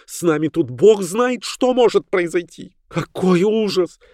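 high, horrified woman's voice; high-pitched frightened wailing; panicky cry. A narrator's reading. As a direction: cry out in rising dread. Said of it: "закричала миссис Роджерс".

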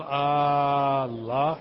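"آه الله" stretched slowly with a rising question tune; drawn-out questioning Allah. Shown as a demonstration. Teacher showing the wrong drawn-out hamza. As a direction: drag the hamza of "آه الله" long and lift it like a question. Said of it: "مد همزة الله".

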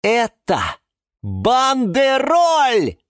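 high, loud, drawn-out announcement; excited exclamation. Acted. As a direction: proclaim it slowly and triumphantly. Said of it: "воскликнул Юра". (торжествующе восклицая)

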